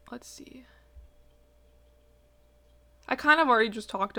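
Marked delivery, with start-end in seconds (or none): 0.1-0.6 soft whispered aside to herself. Real, not acted. Host checking notes mid-podcast, whispered. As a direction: murmur it under her breath as you check notes.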